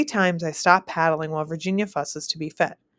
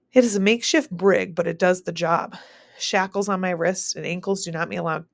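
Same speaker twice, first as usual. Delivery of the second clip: plain reading voice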